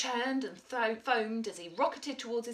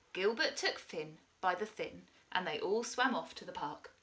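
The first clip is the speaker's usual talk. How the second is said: plain reading voice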